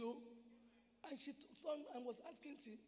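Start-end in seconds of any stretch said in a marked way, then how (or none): none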